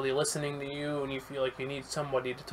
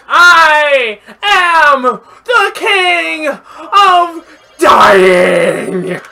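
high, loud, very slow crying shout; hammed-up lament; mock proclamation; 9 semitones higher, 12 dB louder, much slower than usual. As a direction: proclaim it like a tragic hero. Dramatic proclamation.

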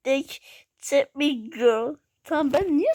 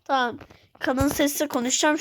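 normal talking voice

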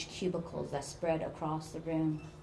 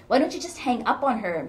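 loud, higher irritated outburst; snapped; 6 semitones higher, 9 dB louder than usual. A narrator's reading. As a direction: snap impatiently. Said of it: "Menno snapped".

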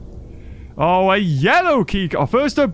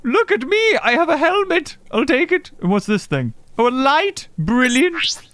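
delighted; 6 semitones higher than usual. Real, not acted. Delighted with his helmet.

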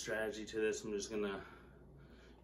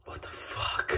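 whispering. Real, not acted. Whispered reaction.

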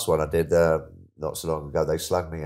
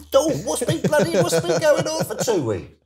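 much higher, louder, half laughing in shock; laughing disbelief; 5 dB louder than usual. Retold or imitated. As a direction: ask the wife what on earth happened, laughing. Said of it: "I'm going".

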